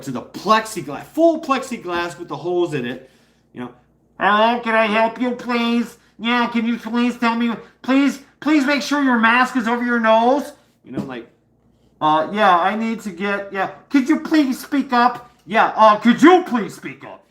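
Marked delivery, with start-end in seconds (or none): 4.2-10.5 high, louder put-on voice of a fussy clerk; a clerk behind plexiglass fussing about the mask, pitched-up mock voice. 12.0-17.2 higher and louder, straining to be heard; mock raised voice. Himself straining through plexiglass.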